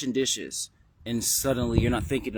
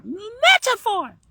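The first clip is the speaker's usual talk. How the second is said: METAPHOR stretched out, very high and loud; kid voice, drawn out; 11 dB louder, much slower than usual. Acted. Kid hammering home the word, long loud high squeal. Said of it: "you just did it in the kid voice".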